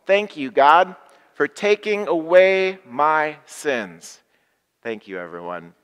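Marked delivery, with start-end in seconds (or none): 0.0-4.2 louder and slow, every word of "for taking away my sins" drawn out; loud unison thanks. Acted. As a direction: lead the whole room in a big slow thanks. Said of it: "Join me here and at home".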